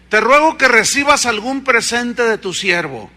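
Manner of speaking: loud ringing scripture reading; preaching scripture. Acted. Preacher reading Naaman's words to the church, loud. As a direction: declaim the verse to the church. Reads Kings passage aloud forcefully.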